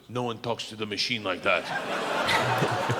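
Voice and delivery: low, slow menacing line; a hint of mobster menace; lightly voiced tough Russian. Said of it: "and goes".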